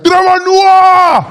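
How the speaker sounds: very high, loud, drawn-out yell; Afrikaans fan's holler. Acted. Afrikaans fan calling across the road, huge stretched shout. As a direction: holler the full name, holding the end. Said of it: "he's there, he's like".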